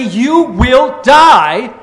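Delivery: loud, slow, commanding decree; emphatic. Acted. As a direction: proclaim it as God's firm warning. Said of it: "God said emphatically".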